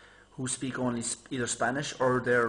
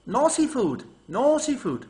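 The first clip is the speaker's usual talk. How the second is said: higher, louder insistent waitress; imitating waitress; 11 semitones higher, 8 dB louder, slower than usual. Waitress telling the waiter, higher and louder mock insistence. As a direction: drill it into him like a bossy waitress. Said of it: "she says to him".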